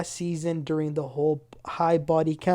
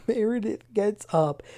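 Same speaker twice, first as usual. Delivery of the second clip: ordinary talk, nothing marked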